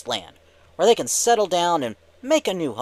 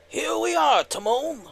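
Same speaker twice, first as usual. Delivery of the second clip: high-pitched, excited cartoon sidekick; Pumbaa; 12 semitones higher than usual. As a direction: call out to your buddy in a big cartoon voice. Puppet-style skit voicing Pumbaa.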